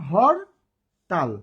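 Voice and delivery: Hartal split as "hor - tal" with a clear pause; syllable by syllable. Shown as a demonstration. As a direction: say হর, stop, then তাল. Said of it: "কয়বারে উচ্চারণ করছি?". (হরতাল দুই ভাগে, থেমে থেমে)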